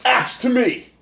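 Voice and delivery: commanding shout. Acted. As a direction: bellow for the axe. Calling the axe to hand.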